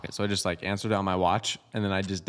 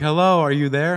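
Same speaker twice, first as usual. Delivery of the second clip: raised, higher mock caller checking the line; calling out; 6 semitones higher, 7 dB louder than usual. Telemarketer to a silent victim, calling out. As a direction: imitate a caller calling louder into a silent phone.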